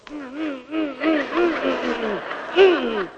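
urgent hushing hums. Retold or imitated. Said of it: "I go".

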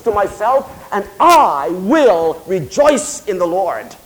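slow, measured pulpit reading; scripture declamation. Real, not acted. Preacher reading Habakkuk to congregation, slow and declamatory. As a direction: declaim each clause with drawn-out weight.

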